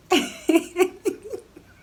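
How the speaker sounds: loud high burst of laughter; big rising laugh. Real, not acted. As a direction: crack up loudly. Laughing at own question.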